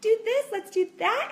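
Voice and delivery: very high, excited kiddie-playtime voice; hammed-up chirpy parent; perky mom voice. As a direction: cheer on small children in a high singsong. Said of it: "I'm talking like this all day".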